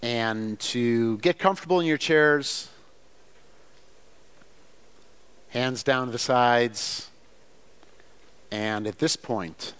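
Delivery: measured phrases with long pauses; guided visualization. Acted. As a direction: guide listeners gently along, gradually building in pitch and energy.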